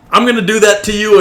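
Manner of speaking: loud fast threat; mock yell. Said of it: "you were about to yell".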